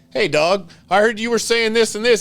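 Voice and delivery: loud mock confrontation. Shop owner calling out a customer's club president, loud and high. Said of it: "I go".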